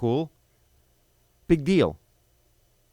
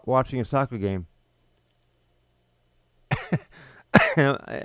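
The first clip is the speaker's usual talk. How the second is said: usual speaking voice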